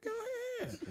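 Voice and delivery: high, soft, eager yes; mock eager agreement. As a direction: burst out with delight but softly. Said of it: "I was like".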